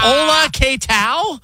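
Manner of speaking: high, slow mock Spanish greeting. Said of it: "put on a sombrero".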